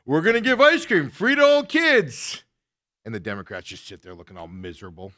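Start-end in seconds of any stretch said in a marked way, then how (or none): 0.1-2.4 mock Trump. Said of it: "he's literally like".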